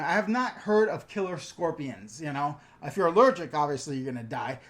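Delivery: ordinary talk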